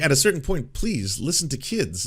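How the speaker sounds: ordinary talk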